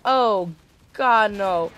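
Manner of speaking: drawn-out retold dread; stunned groan of disbelief; jaw-dropped dismay. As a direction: stretch each word in mock horror. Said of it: "jaw dropped".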